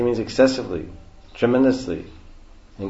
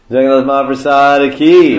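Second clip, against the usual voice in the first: loud, high rallying call; call-and-response cheer; 4 semitones higher, 10 dB louder than usual. Real, not acted. Leader calling congregation to answer Jai, rousing shout. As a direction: call it out to rouse the crowd.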